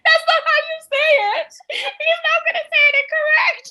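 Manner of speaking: high, loud laughing through tears; laugh-crying as she tells it. Real, not acted.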